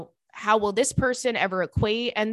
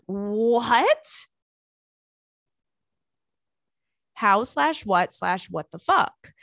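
shocked disbelief; 2 semitones higher, 5 dB louder, much slower than usual. Real, not acted. Reacting to dick pics in letter.

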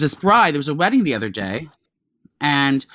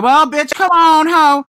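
high, loud sassy voice; mock diva calling out; sassy sister; 11 semitones higher, 6 dB louder, slower than usual. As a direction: bark it out like a brash sister. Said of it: "She's like".

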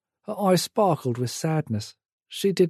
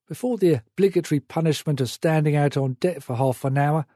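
usual speaking voice

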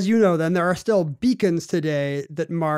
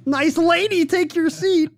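high, goofy comic voice, much louder; Jerry Lewis; 11 semitones higher, 5 dB louder, much slower than usual. Pretend. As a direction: squeal it like a zany comedian. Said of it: "That's a terrible Jerry Lewis impression".

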